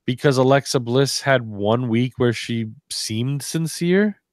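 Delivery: ordinary talk, nothing marked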